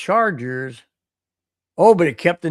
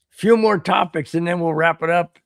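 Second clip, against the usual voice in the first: ordinary delivery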